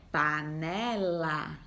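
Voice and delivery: panela said very slowly; slow, stretched. Shown as a demonstration. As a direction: draw out panela syllable by syllable. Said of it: "O som final é o lá".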